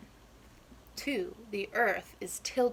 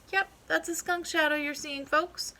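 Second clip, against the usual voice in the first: folksy reporter; 6 semitones higher, 3 dB louder than usual. Acted. Groundhog reporter addresses folks.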